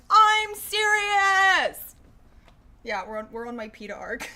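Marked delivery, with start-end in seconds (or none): 0.1-1.9 a drawn-out, very loud, much higher mock shout; mock yell. Insisting to joking fans, mock yell. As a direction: stretch it into a hammed-up indignant yell. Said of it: "And I'm like".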